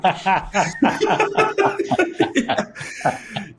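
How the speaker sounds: big high burst of laughter. Real, not acted. Host laughing at his own chatbot joke, big high laugh. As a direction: laugh at the idea of thanking a chatbot.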